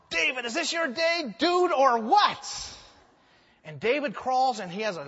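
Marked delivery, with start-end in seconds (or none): 0.1-2.7 higher, shouted goading, last words drawn out slow; David's men goading him to kill Saul; excited goading. Acted. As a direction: hype him up like a buddy.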